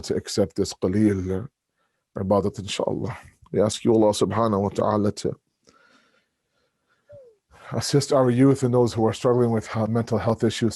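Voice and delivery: low, hushed prayer; subdued, low-pitched plea to God; closing supplication. Real, not acted. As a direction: bring the voice right down to pray.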